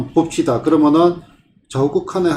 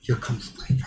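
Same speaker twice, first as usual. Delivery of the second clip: gasp, then a hushed whisper; breathy, barely voiced mock alarm after a gasp; mock dismayed gasp; 2 semitones lower, 12 dB quieter, faster than usual. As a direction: whisper it in pretend shock. Acts dismay at spotting inverse function.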